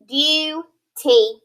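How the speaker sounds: "Duty" split slowly as DOO, TEE; syllable by syllable. Shown as a demonstration. Teacher splitting a word between U and T, syllabified "Duty". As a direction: pause between the two halves of "Duty".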